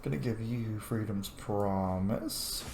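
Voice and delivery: ordinary talk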